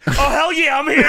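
high, excited wrestler impression; hammed-up high holler; Booker T. Answering his wife about the visitor, hammed-up high impression. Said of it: "And he says".

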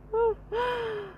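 a short, high, soft giggle of scorn; high mocking giggle. Real, not acted. Laughing at the fan's foolishness, a high soft giggle. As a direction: let out a squeaky laugh at him.

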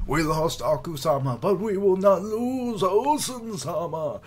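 desperate battle cry. Acted. Soldier vowing to protect Osen, loud, high battle cry. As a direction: scream it to the whole army.

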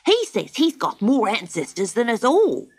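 quick rat protest. A narrator's reading. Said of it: "cried the rat".

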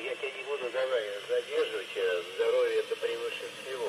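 ordinary delivery

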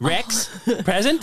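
put-on high New Zealand-accented clone answering roll; roll call voice. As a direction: pipe up high in a Kiwi drone.

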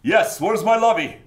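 high, loud aristocrat through the teeth; haughty call through clenched teeth; Thurston the thirty-third. Acted. Rich young man arriving among the crowd, loud aristocratic call. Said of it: "aristocratic mien of talking through his teeth".

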